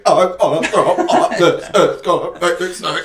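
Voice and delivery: high, put-on sick whine; mock queasy wail. Showing how they announce nausea, queasy wail. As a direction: wail as if about to vomit. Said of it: "When I feel like I'm gonna throw up".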